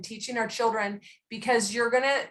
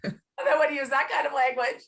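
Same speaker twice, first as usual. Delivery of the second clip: mock weeping daughter; 6 semitones higher than usual. Twelve-year-old daughter crying at mother's language, mock sobbing. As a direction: play the devastated child, half laughing.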